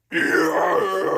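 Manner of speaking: high raspy scream; growl scream. Shown as a demonstration. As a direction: rip out a raspy yell. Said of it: "That's what you wanna sound like".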